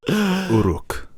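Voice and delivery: Uruk said with a pointed British accent. Shown as a demonstration. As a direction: Uruk in a mock Queen's English voice. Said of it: "I love the way you said that".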